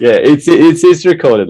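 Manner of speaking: high, loud laughing through the words. Real, not acted. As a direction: laugh it out delightedly.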